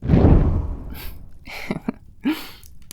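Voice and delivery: a pleased, airy demon cackle. Acted. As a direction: laugh out, delighted with the signature.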